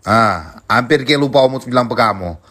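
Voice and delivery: ordinary talk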